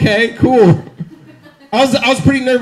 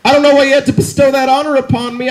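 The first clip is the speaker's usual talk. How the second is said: normal talking voice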